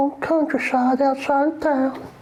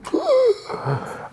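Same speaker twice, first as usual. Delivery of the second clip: high gasping breath; hammed-up gasp; excited gasp; 11 semitones higher than usual. Shown as a demonstration. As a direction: suck air in sharply. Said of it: "I breathe like that when I get excited".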